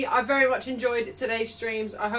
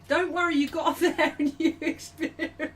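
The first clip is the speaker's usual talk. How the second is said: higher-pitched words dissolving into laughter; cracking up; 5 semitones higher than usual. Real, not acted. Streamer consoling Arsenal fans, collapsing into laughter. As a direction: start consoling, then lose it laughing.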